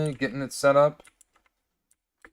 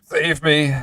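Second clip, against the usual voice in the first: loud plea; 5 semitones higher, 11 dB louder than usual. Pretend. Mock plea during hiccup fit.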